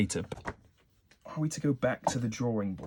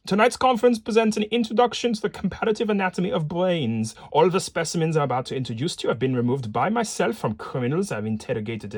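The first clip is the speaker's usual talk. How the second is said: put-on raised, lilting Viennese-accented lecturer; 3 semitones higher, 4 dB louder than usual. Professor welcoming his conference audience, Viennese accent. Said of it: "shall I do a Viennese as-, accent".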